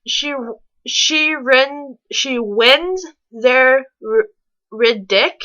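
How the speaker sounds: ordinary talk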